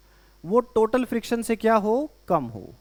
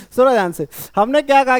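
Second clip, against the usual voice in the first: louder call to the class to listen; calling for attention; 3 semitones higher, 7 dB louder than usual. Real, not acted. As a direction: raise the voice to pull the room back. Teacher calls class to attention. (कक्षा का ध्यान खींचती ऊँची आवाज़)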